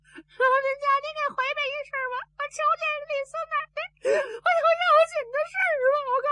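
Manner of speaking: high-pitched, urgent wail; distraught messenger. A narrator's reading. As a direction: plead at the top of the voice range. (赵壁尖着嗓子急切报信)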